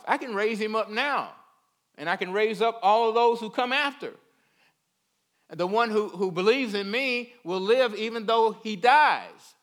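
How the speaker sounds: high, loud preaching declaration; forceful proclamation. Acted. Preacher speaking as Jesus promising life to believers, forceful and loud.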